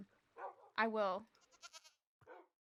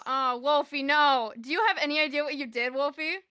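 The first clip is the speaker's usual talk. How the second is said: loud, higher protest; mock-dismayed scolding; 4 semitones higher, 12 dB louder than usual. Real, not acted. Streamer tells Wolfie off for the redeem, loud mock dismay. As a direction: scold loudly in mock despair.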